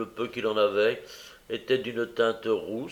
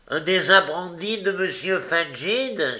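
high, amused exclamation; lightly tickled, raised question; amused cry; 7 semitones higher than usual. A narrator's reading. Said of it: "s'écria Barney en riant".

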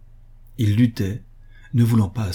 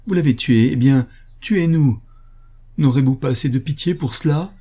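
high, louder desperate plea; crying out; 6 semitones higher, 4 dB louder than usual. A narrator's reading. Bereaved mother at the officer's horse, crying out in despair. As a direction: cry out a challenge to the killer. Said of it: "cria-t-elle".

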